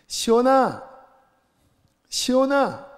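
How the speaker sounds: high, loud call across a room. Retold or imitated. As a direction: call the boy's name from afar. Father calling son across room. (멀리 있는 아들 시온이를 부르는 높은 외침)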